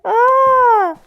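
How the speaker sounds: high, loud cry of laughter; loud laughing shriek. Real, not acted. Caster helpless at the players, loud laughing shriek.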